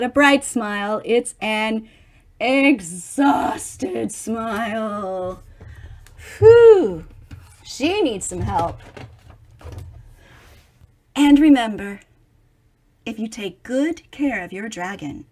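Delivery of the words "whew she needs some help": ordinary delivery, nothing marked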